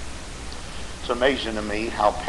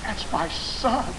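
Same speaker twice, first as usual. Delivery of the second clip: dawning horror; 9 semitones higher, 5 dB quieter, much slower than usual. Acted. Father recognizes son's screams.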